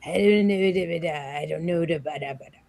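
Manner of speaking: garbled low Batman voice like gargling marbles. Acted. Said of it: "You sound like you're gargling marbles".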